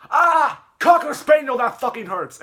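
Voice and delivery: high, loud cry of pain; pained yell. Retold or imitated. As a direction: scream it as the tooth hurts. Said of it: "he always yells".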